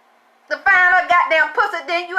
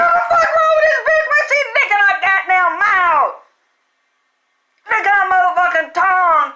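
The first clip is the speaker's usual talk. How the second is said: laughing shout; 5 semitones higher than usual. Retold or imitated. Mother yelling at her son over the girl, laughing shout. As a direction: yell in shocked disbelief, laughing.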